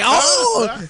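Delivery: high startled yelp, laughing; mock startled yelp. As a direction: yelp as the gator lunges. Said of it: "They're like".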